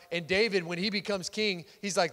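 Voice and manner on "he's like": ordinary delivery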